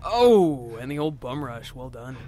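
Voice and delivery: ordinary talk, nothing marked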